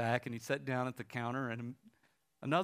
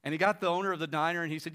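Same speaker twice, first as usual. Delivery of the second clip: normal talking voice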